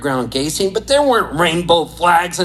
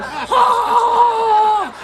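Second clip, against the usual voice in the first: very high shrieking laugh. Retold or imitated. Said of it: "everybody going".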